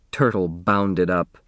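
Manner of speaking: ordinary delivery, nothing marked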